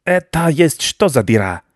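suspicious rodent demand; suspicious rat. A narrator's reading. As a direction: question it distrustfully as a rat baron. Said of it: "с подозрением спросил крыс".

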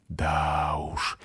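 низко и тихо, почти шёпотом; пробормотать вполголоса, на низкой ноте; приглушённый шёпот. A narrator's reading. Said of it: "прошептал он через пару минут". (тихий, сдавленный полушёпот)

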